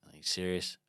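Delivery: soft, deadpan incredulity; quiet disbelief. Retold or imitated. Runner answering an inner boss voice, quietly incredulous. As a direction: question the order under your breath.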